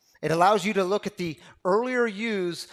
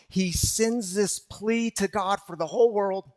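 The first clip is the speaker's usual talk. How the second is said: ordinary talk, nothing marked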